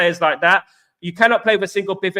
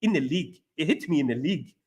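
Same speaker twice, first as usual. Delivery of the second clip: low, softer South African accent; 4 semitones lower, 7 dB quieter than usual. Shown as a demonstration. Streamer showing off the only South African line he can do, South African accent. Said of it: "South African accent, I can only do".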